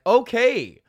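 high, loud fed-up okay; exasperated okay. Retold or imitated. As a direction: throw up your hands at the crowd. Exasperated quote to crowd.

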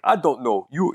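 lightly imitated Nigerian accent. Shown as a demonstration. Showing a friend how the taxi driver sounded, light Nigerian accent. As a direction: give a hint of a Nigerian accent. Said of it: "Nigerian accent is kinda like".

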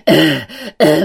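out of breath grunting; panting grunts. Retold or imitated. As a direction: gasp out short grunts. Gasping while running.

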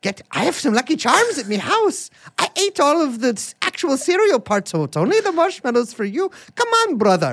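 high, bad Irish accent; hammed-up mock-Irish lilt; Lucky Charms leprechaun. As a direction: wheedle like a cartoon Irish leprechaun. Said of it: "you have to say the Lucky Charms".

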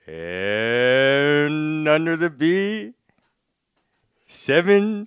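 slow, loud drawn-out number call; bingo caller. Pretend. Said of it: "I'm gonna have to be, like, putting on a show".

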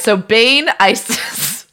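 ordinary delivery

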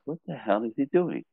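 soft mutter under the breath; muttered aside. Retold or imitated. Seeing Dana wave him over, a soft confused mutter. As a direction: grumble it half to yourself.

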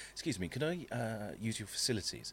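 put-on quiet, courteous Southern accent requesting the toilet; polite southern accent. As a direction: sweetly ask in a Southern accent. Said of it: "in a nice southern accent".

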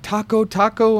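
put-on high, excited kid calling taco; a hint of an eager little kid; imitating local kid. Local kid offering octopus, lightly voiced kid. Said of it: "this little kid was like".

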